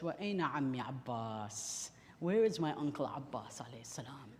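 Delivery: low, hushed and mournful; soft sorrowful question. Retold or imitated. Wounded son asking his father after his uncle, hushed. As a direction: ask it softly, dreading the answer. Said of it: "Now Imam Zain al-Abidin says".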